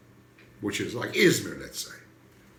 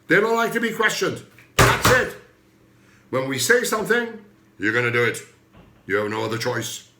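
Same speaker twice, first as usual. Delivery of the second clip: louder, higher, barking authority; stern authority voice; 5 semitones higher, 7 dB louder than usual. Retold or imitated. The authorities ordering people who ask questions, loud and stern. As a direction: lay down the law like a stern official. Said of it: "With this voice".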